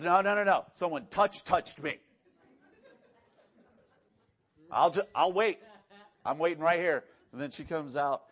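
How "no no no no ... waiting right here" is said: firm and loud, holding ground; insistent. Retold or imitated. Jesus insisting to Peter that someone touched him, firm. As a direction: insist you'll wait it out. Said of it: "He goes".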